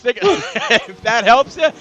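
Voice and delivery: laughing. Real, not acted. Laughing after his impression.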